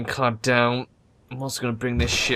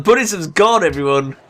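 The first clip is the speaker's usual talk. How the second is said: much higher and louder, gleeful; more excited; 6 semitones higher, 6 dB louder than usual. Real, not acted. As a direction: let the glee climb even higher. Continues the gleeful climb.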